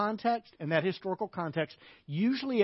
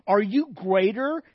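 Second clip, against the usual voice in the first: louder, drawn-out mock question; sarcastic question; 3 semitones higher, 6 dB louder, slower than usual. Woman doubting Jesus's claim, sarcastic. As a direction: challenge him with slow, loud sarcasm. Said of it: "There's sarcasm in this".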